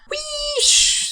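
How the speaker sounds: very high, steam whistle hiss; hissing wheesh. A narrator's reading. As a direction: blast out a cheeky steam hiss. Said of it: "said Percy".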